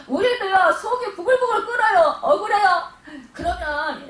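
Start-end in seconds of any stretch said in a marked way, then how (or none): 0.1-2.9 higher, louder, laughing over the boiling anger; mock seething. Preacher admits to the church that inside it boils, laughing. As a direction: let the frustration burst out, half laughing.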